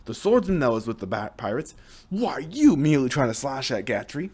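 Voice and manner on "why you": higher, louder angry yell; angry outburst. Acted. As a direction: snap at the enemy.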